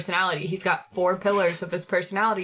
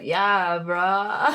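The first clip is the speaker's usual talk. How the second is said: surfer dude voice; 4 dB louder than usual. Retold or imitated. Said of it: "like, a California surfer dude".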